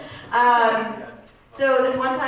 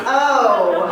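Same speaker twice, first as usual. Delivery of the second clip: normal talking voice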